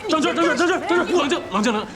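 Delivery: high, loud pleas to calm down; urgent calming shout. Acted. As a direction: shout his name to stop him. Doctor restraining an agitated patient. (高声急切地劝他冷静)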